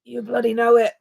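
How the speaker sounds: a weak, barely Scottish "You bloody know it"; bad Scottish accent. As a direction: try a Scottish accent and miss. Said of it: "That was awful".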